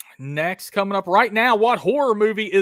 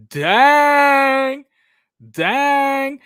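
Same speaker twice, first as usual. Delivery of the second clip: loud dismay; 8 semitones higher, 7 dB louder than usual. Real, not acted. Reacting to a player leaving.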